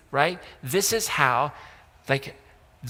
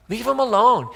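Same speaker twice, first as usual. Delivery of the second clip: higher, louder "Leave 'em alone"; emphatic repeat; 7 semitones higher, 5 dB louder than usual. Retold or imitated. Preacher repeating Jesus' dismissal of the Pharisees, louder. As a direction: punch "Leave 'em alone" up high and loud. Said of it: "And he's like".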